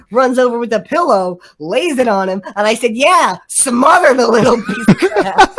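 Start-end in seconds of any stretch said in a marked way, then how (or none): 3.0-5.5 louder, higher mock fury with a laugh; hammed-up anger, laughing through it; triggered. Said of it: "I love how triggered you got towards the end".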